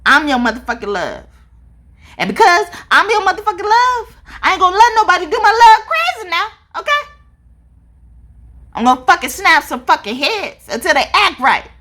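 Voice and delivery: high, loud, fierce warning as a protective god; God's threat. Retold or imitated. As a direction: bellow it like a jealous deity guarding his love. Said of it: "God is saying that".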